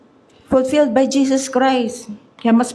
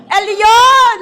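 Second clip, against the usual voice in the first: loud, high crying plea; a stressed believer crying out to God; desperate cry; 9 semitones higher, 7 dB louder than usual. Pretend. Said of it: "pag stress na stress ka na".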